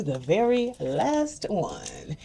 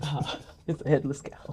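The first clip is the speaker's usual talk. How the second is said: laughing; 4 semitones lower, 8 dB quieter than usual. Real, not acted. Joking about eaten cow snack.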